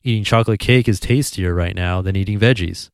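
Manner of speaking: normal talking voice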